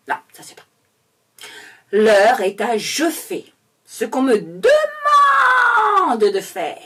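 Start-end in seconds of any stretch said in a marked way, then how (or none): 1.9-6.8 slow mock proclamation with a shouted, drawn-out DEMANDE; overdone official decree; minister's speech redone. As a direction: hammer it out slowly, stretching demande into a holler. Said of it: "refaire le discours du monsieur en étant un petit peu plus clair".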